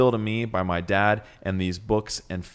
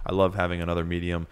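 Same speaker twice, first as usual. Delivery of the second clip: normal talking voice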